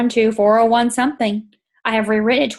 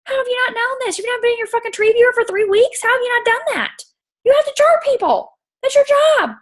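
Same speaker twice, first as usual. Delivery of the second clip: exasperated scolding; 12 semitones higher than usual. Retold or imitated. To Alana about the missed cancellation, high exasperated scolding. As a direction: scold her for not checking her team. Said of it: "I'm like".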